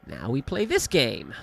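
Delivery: usual speaking voice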